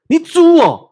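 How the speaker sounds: a loud, high scolding yell at an employee; boss yelling insult. Retold or imitated. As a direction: bark the insult out like an angry boss. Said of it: "他会骂他的员工说".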